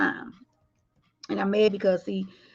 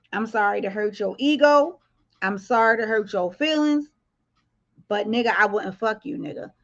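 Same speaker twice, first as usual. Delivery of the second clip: ordinary talk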